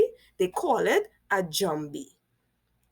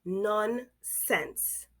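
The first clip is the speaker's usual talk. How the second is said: normal talking voice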